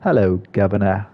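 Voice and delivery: lightly put-on British accent; half-hearted British accent; British impression. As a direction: try a British greeting, not very convincingly. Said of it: "that's my British impression".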